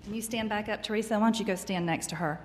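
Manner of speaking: ordinary talk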